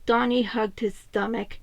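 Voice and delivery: usual speaking voice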